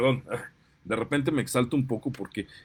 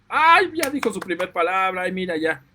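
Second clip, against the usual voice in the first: put-on much higher and louder, gushing over the child's first word; proud parent squeal; 5 semitones higher, 6 dB louder than usual. Parent cheering the child's first swear word, excited mock squeal. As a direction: play a thrilled parent celebrating a bad word. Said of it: "el niño dice".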